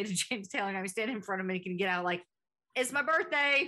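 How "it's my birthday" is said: mocking higher-pitched starstruck blurt; dumbstruck fan. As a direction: imitate a husband reduced to a blurting fool. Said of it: "rock level of intelligence".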